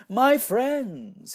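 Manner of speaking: ringing high salesman call; bright carnival barker greeting; clear keen announcer. A narrator's reading. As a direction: call out like a showman hawking wares. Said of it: "he announced in a voice clear and keen".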